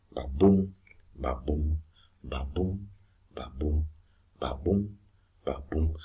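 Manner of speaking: soft, steady Ba-boom pairs, boom stressed; drumbeat rhythm. Shown as a demonstration. Teacher showing how verse moves a play, drumbeat rhythm. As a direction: tap out Ba-boom quietly and evenly like a drum. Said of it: "like a drum beat".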